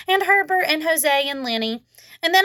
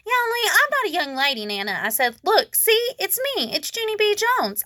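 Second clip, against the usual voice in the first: put-on eager child insisting; 8 semitones higher than usual. A narrator's reading.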